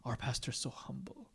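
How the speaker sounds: hushed mock admiration; admiring whisper. Congregant admiring the humble pastor, hushed whisper. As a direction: whisper it in awe. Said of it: "Hoping that you'd be like".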